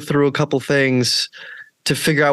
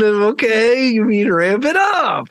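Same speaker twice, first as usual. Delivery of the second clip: mock dismay; 8 semitones higher, slower than usual. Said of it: "And I was like".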